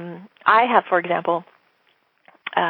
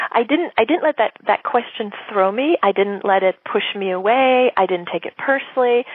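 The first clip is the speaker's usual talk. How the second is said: normal talking voice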